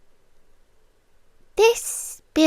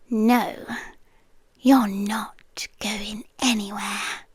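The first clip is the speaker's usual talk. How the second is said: low, much softer whisper, firm but gentle; possessive whisper; 5 semitones lower, 6 dB quieter than usual. Acted. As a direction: hold him there with a quiet whisper. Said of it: "grab him by the chin and say".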